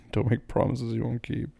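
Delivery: ordinary talk, nothing marked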